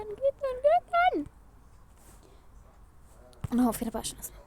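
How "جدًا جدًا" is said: high, drawn-out begging; pleading squeak. Acted. يقسم إنه يحب ليالي بتوسل. (توسل بصوت عالي ممطوط)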